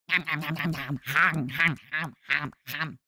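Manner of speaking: low, soft playful munching; munch greedily on imaginary food, voice low; mock eating noises. Streamer pretends to gobble scallops, playful munching.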